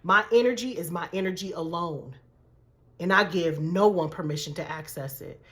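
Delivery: low, soft affirmation; prayer with conviction. Shown as a demonstration. Coach modeling a protective prayer for viewers, quiet conviction. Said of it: "say that prayer with conviction".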